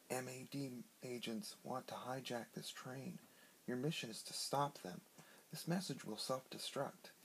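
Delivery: quiet, secretive recorded message; soft message voice. A narrator's reading.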